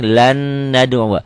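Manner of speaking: "Lan nad'u" slow, long doubled N; merged N. Shown as a demonstration. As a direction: let the N of "Lan" flow into "nad'u". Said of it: "masuk ke sini".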